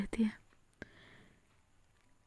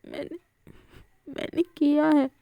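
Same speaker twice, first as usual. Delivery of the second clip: higher, teary broken admission; crying voice; 5 semitones higher than usual. Acted. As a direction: speak through tears, faltering. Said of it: "रोने वाले voice में कहती". (रोती हुई आवाज़ में, टूटते शब्द)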